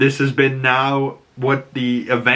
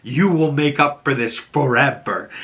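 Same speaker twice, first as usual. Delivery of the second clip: dramatic angry tirade climbing high in pitch; performed righteous anger, higher and deliberate; pissed-off, acted; 5 semitones higher than usual. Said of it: "the girl's so pissed".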